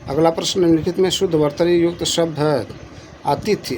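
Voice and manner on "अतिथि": ordinary delivery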